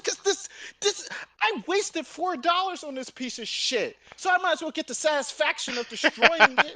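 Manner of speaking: high, rising, fired-up rant; high heated mock defense; heated justification. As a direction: justify it all worked up.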